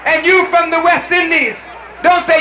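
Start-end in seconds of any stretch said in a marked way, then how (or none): none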